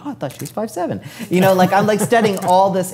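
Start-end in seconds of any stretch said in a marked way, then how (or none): 0.1-1.0 surprised. Retold or imitated. Surprised reading a model card.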